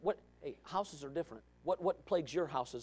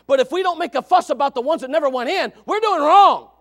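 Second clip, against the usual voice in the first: loud, high, hammering sermon cry; fiery preaching; 12 semitones higher, 21 dB louder than usual. Real, not acted. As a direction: preach it out at full force. Preaching at full force.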